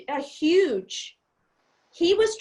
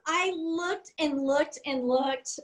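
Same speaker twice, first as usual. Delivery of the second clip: ordinary talk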